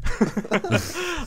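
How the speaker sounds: high-pitched laugh after the joke; high burst of laughter. Real, not acted. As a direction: break into a squealing laugh.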